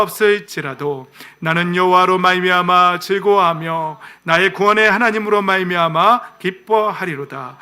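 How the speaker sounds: slow, measured congregational reading; recited scripture. Real, not acted. Preacher leads everyone reading Habakkuk's confession, slow solemn recitation. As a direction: read in unison, building to a loud declaration. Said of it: "다 같이 한번 읽어볼까요".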